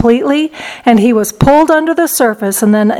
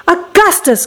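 higher-pitched motherly call, mildly alarmed; crying out; 7 semitones higher than usual. A narrator's reading. Mother calls to her greedy son, crying out. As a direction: call out the boy's name in mild alarm. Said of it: "cried Missus Gloop".

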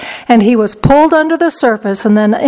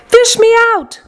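high, urgent plea; yelling; 12 semitones higher than usual. A narrator's reading. Boy in the chocolate river begs rescue, yelling. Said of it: "he yelled".

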